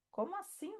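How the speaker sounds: what do you mean, really?; incredulous question. Acted. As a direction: ask it in high, puzzled disbelief. Mock disbelief at viewer's belief.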